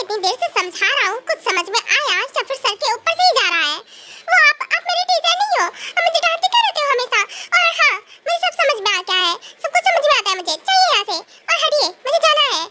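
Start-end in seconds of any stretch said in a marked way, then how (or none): 4.3-12.6 high, cheeky schoolgirl voice pushing back; a pert girl talking back to her teacher; sassy young girl. Acted. Sassy girl snapping back at the scolding teacher.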